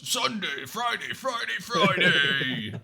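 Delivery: booming monster truck ad voice; monster truck announcer. Acted. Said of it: "the monster truck voice".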